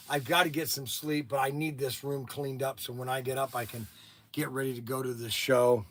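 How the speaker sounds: low, soft plea to a front desk; retold subdued, apologetic request; apologetic phone request. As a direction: sheepishly ask for help, voice dropped. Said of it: "I called down to the front desk".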